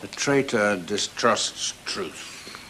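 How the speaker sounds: slow and low, every consonant of traitor distrusts truth placed. Shown as a demonstration. Teacher repeating the twister, slow and low. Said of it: "Tongue twisters".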